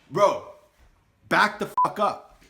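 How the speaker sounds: loud, slowed, threatening; telling the knife guy to back off, loudly; retold loud warning. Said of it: "Don't get loud with me".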